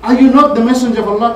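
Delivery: indignant challenge. Retold or imitated. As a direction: demand it, rising in outrage. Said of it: "Look to how Omar speaks now".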